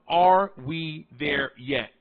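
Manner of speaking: slow, each word set apart; spaced out, one deliberate word at a time; broken down. Shown as a demonstration. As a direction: break the question down word by word. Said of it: "I break down".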